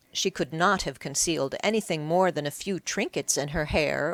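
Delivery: ordinary delivery, nothing marked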